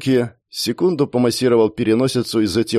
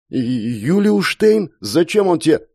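startled stammer; 7 semitones higher, slower than usual. Acted. Rupert stammers, startled by the name.

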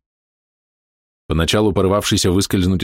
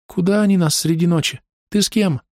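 put-on little girl voice; 11 semitones higher than usual.

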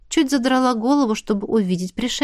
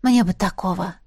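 plain reading voice

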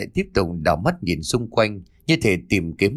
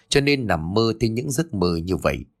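usual speaking voice

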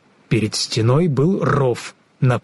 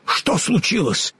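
higher-pitched startled question; Scarecrow character; 7 semitones higher than usual. A narrator's reading. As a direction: ask in a raised, concerned Scarecrow voice. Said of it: "спросил Страшила".

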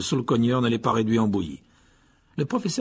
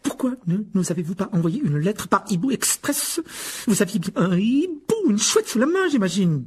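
higher and louder, tart scolding teacher; dry, curt question; 9 semitones higher, 4 dB louder than usual. A narrator's reading. The head of house grills Harry about the owl, curtly. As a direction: snap it at him, rising in pitch. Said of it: "demanda sèchement".

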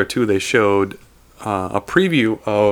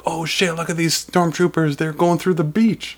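surprised; 7 semitones higher than usual. Retold or imitated. Fans reacting to the trailer scene.

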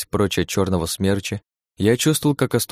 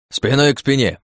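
shout; 6 semitones higher, 4 dB louder than usual. Acted. Partner shouts in battle.

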